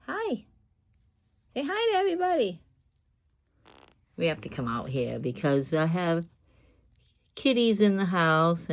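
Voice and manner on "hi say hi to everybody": coaxing pet talk. Real, not acted. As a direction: prompt a pet in a tiny high voice. Coaxing the parrot to greet.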